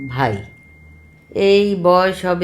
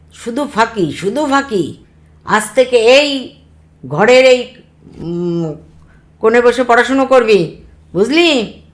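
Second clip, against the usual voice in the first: loud, raised-pitch telling-off; stern scolding; 7 semitones higher, 7 dB louder than usual. Acted. Uncle scolding nephew caught shirking, loud and stern. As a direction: lay down the law to a shirker.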